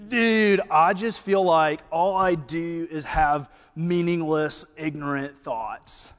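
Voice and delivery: put-on Brewton surfer-Southern accent. Connor after the sermon, in a surfer-dude Southern accent. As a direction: do the Brewton accent, admittedly botched. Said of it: "I'm gonna totally botch the accent".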